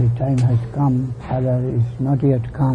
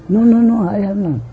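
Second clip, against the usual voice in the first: frightened denial; 8 semitones higher, 4 dB louder than usual. Retold or imitated. Child denies to mother.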